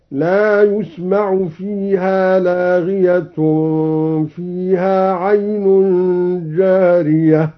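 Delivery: يسمع as "yusma'" with u, slow recital; يسمع and لاغية in the nominative, recited slowly. Shown as a demonstration. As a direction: read يسمع passive with ya. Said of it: "قرأ الدوري بياء مضمومة ... هكذا".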